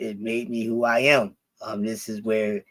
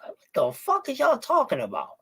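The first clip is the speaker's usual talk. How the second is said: incredulous raised voice; indignant; 11 semitones higher than usual. Retold or imitated. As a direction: push back in disbelief. Retelling his own indignant reply.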